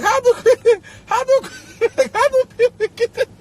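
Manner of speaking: high-pitched question through laughter; too tickled to get the question out; incredulous, laughing squeal; laughing disbelief. Real, not acted. Cracking up at fake posting.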